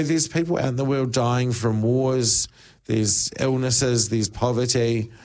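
usual speaking voice

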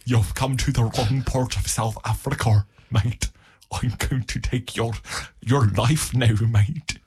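hammed-up South African threat; South African accent. Local threatens a newcomer over his turf, in a South African accent. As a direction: play a menacing local in mock South African. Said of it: "South African accent".

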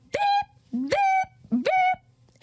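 very high, piercing alarm beeps; alarm beeping imitation. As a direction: imitate a shrill alarm clock going off. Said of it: "They're always like".

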